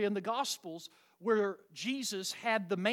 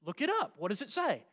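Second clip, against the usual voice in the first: ordinary talk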